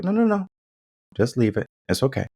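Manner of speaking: high, quick reassuring refusal; waving someone off. Retold or imitated. Dad stopping a mom from stepping in, waving her off.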